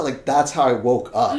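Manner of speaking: ordinary delivery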